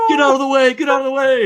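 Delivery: high, loud mock yell of panic; movie shout. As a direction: yell it like a henchman about to be run over. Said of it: "and he's like".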